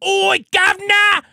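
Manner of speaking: loud, high-pitched hammed-up Cockney; over-the-top British caricature shout; British accent. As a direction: holler it like a mock British street yob. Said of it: "some British people that go".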